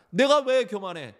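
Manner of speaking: high, loud, indignant comeback; angry retort. Acted. Proud person retorting to being called proud, angrily. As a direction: fire the question straight back, offended. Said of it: "반문을 하고 화를 내고".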